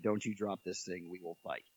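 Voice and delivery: low, very soft retold warning; quiet mock threat. Dad stares down Rapunzel over the ring, hushed mock threat. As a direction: threaten under your breath, playfully, voice falling away.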